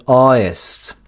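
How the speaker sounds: slow artist with the T gone into a vowel glide; vocalised glottal. Shown as a demonstration. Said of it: "Le A du début du mot se transforme en E".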